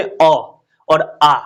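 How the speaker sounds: अ kept short, आ drawn out longer; short and long vowel demo. Shown as a demonstration. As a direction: contrast a short अ with a stretched आ. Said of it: "दोनों में अलग-अलग समय लगता है". (छोटा अ और खिंचा हुआ आ)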